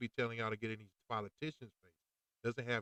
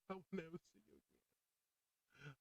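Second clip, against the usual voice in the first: soft, high, slow and near breaking; choked-up whisper of a farewell; tearful goodbye; 6 semitones higher, 16 dB quieter, slower than usual. Retold or imitated. Patient leaving the doctor, near tears. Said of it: "I kinda cried a little bit".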